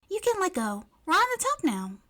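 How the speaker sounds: put-on high, cheerful girl voice; girl coaxing her clinging friend, high; bright May. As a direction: coax her to let go brightly. May reassures clinging Momo.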